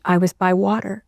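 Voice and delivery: ordinary talk, nothing marked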